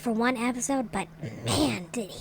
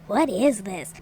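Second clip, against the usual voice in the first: ordinary talk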